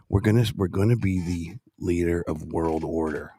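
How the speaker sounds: low, deliberate tough-guy speech; Dirty Harry. Shown as a demonstration. Coaching a candidate's speech on world order, deep tough voice. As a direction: speak it deep like a hard man. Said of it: "I sound like Dirty Harry".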